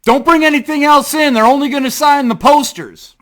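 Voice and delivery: loud, high shouted announcement; yelling out. Retold or imitated. Someone to fans about the poster signing, yelling out. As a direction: holler instructions across the tent. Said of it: "yell out".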